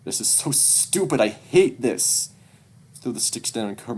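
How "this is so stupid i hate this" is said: higher-pitched, upset outburst; frustrated outburst. Acted. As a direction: burst out in frustration, stretching the last words.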